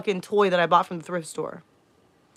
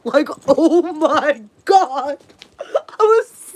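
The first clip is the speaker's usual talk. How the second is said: high-pitched laughing burst, louder; 8 semitones higher, 8 dB louder, much slower than usual. Real, not acted. Recalling the stepdad's question, real laughter. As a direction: crack up at the absurdity.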